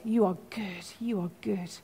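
very soft, hushed and intimate; murmured prayer. Retold or imitated. Preacher repeating simple words to God when prayer won't flow, murmured softly. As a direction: say it softly, over and over, like a private prayer. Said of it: "sometimes I just say the words".

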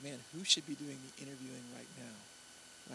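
low, very soft retold thought; humbled aside. Interviewer privately outclassed by the student, humbled. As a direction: drop to a hushed, impressed murmur.

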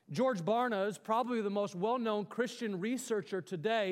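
ordinary delivery

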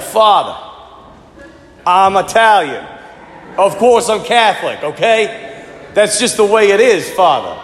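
insistent mock Jersey Italian accent. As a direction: play a Jersey Italian guy setting the priest straight. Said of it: "a Jersey Italian accent for you".

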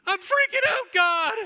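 shrieked very high and loud; a mock panicked scream; freaking out. Worshipper shrieking panic up to God. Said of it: "we don't really hear that in our modern worship songs".

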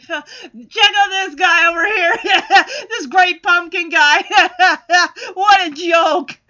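loud, laughing ridicule; mock cackling at a joke; mocking laughter. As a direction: laugh at him between the words. Snoopy mocking Linus, laughing.

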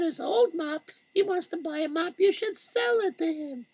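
sly shopkeeper voice, pitched high; Malky. Acted. Malky scolds Sender.

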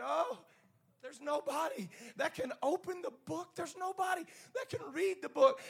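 lightly tearful high lament; acted weeping. Preacher voices John weeping over the sealed book, high lament. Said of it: "He starts weeping".

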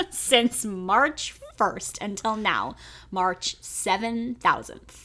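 slow, stretched mock-weary words; drawn-out joke. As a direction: drag it out as if time never ends. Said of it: "I don't know what time is".

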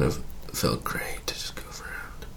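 breathy whisper. Real, not acted. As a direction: drop to a whisper. Trails into whisper.

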